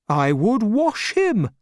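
put-on high, simple old man's advice; Mister Dick's solution; Mister Dick deciding. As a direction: state it plainly and proudly. Dick voiced.

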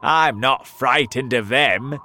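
loud storybook defiance; defiant shout. A narrator's reading. Centipede answers the scared earthworm, defiant shout. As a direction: shout it boldly. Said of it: "the centipede shouted".

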